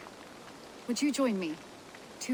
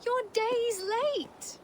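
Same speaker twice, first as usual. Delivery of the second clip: high, drawn-out indignant cry; indignant protest; exasperated scolding; 13 semitones higher, much slower than usual. Acted. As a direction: stretch out the complaint, high and slow. Scolds late brother.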